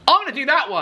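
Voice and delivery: eager yes. Retold or imitated. Eager reply to offer.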